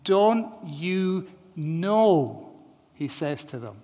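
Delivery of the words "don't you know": rhetorical question. Retold or imitated. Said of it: "the form in which that question takes".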